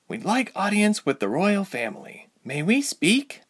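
heroine voice. A narrator's reading. Ilse voiced, higher.

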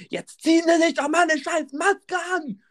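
very high, shrieky imitation; mock outraged scolding. Older passenger scolding man without mask, mock shriek. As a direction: screech it like an outraged busybody. Acting out Ute scolding Mark.

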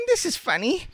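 very high, piping voice; mistress crying out. A narrator's reading. Mistress puzzled by her dolls, crying out. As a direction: exclaim it in falsetto. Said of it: "cried Mistress".

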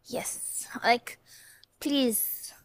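breathy pleading whisper. Acted. Begging family members listening, whispered. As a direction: hush it like a secret plea.

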